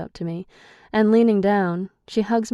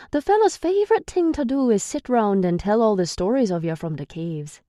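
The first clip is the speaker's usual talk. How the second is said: raised, scornful Irish male voice; Declan, Irish brogue; 4 semitones higher, 5 dB louder than usual. A narrator's reading. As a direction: sneer at her in a higher Irish lilt.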